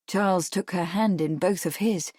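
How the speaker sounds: ordinary delivery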